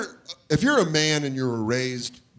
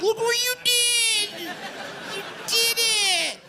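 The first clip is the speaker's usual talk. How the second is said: very high, drawn-out squeal at a dog; gushing pet talk; much slower than usual. Acted. Owner showing the dog what it did, squealing. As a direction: squeal slowly, like praising a puppy.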